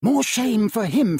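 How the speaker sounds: wife indignant at her husband's uncle. A narrator's reading. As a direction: scold with feeling. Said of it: "said Scrooge's niece indignantly".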